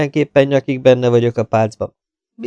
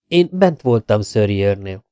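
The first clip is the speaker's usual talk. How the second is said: ordinary delivery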